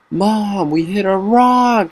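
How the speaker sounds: high, slow blurted announcement; excited tattling. Retold or imitated. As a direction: blurt it out to mom, thrilled to tell. Kid blurts news to mom.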